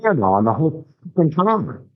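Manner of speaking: lightly hammed-up exasperated buddy; incredulous rant. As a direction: draw it out like you can't believe the idiot. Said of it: "I was like".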